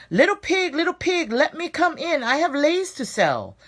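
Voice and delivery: high, sugary put-on calling; sweet lei seller voice. Shark dressed as lei seller calling sweetly, high. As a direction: coo it sweetly, a disguised shark. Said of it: "called sweaty, sweetly".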